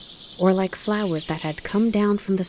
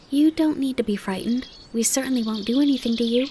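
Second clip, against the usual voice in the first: polite; 7 semitones higher, faster than usual. Acted. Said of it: "said Bambi politely".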